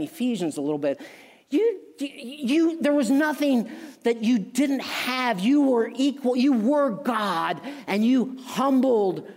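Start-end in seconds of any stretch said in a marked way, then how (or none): 1.5-9.3 preaching at full force. Acted. Preacher exalting God to the congregation, full preaching force. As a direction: lift it like a pulpit climax.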